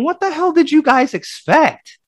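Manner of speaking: 1 incredulous. Retold or imitated. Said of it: "I'm thinking".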